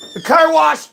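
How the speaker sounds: mocking a clueless person shouting a random answer; mock blurted answer. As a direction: blurt it out like a confused dimwit. Mock dim answer to dinner question.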